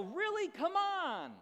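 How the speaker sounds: loud, high yell on a golf course; frustrated yell. Retold or imitated. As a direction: shout at the sky in disgust. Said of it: "and I yell".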